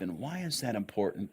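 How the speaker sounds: usual speaking voice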